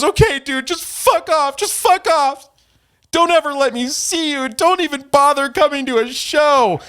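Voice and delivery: very high falsetto mock outrage; mock tantrum. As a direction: imitate him throwing a squeaky fit. Mocking reading of rival's angry DMs.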